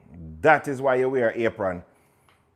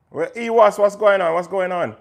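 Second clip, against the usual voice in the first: loud greeting; 7 semitones higher, 5 dB louder than usual. Real, not acted. Calling out a greeting.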